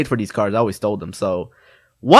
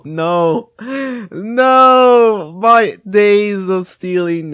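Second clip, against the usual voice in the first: rising panicked wail, higher and higher, slowing at the end; hammed-up despair as the guard closes in; mock panic; 9 semitones higher, 6 dB louder, much slower than usual. Caught shoplifting kid wailing to himself, mock panic.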